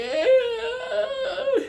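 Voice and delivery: very high drawn-out cry; imitated wail. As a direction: wail like the possessed man. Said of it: "He's there like".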